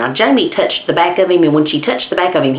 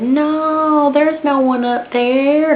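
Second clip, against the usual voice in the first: mother's reassurance; 10 semitones higher, much slower than usual. Retold or imitated.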